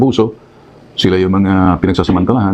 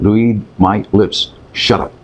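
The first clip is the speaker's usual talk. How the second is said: 1 slow emphatic; much slower than usual. Shown as a demonstration. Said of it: "This is what I'm gonna tell you".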